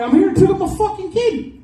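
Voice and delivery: his own voice pushed way up; high-pitched self impression. Comic retelling his school pickup, squeaky high self voice. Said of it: "It's like three octaves higher".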